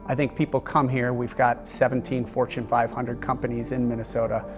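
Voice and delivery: ordinary delivery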